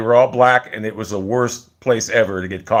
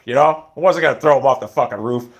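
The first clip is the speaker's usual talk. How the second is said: ordinary talk, nothing marked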